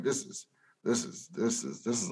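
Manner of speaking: very soft, near-whispered stammer; hushed overwhelmed reaction. Retold or imitated. Viewer watching a tough scene first time, hushed and overwhelmed. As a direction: repeat it under your breath, overwhelmed.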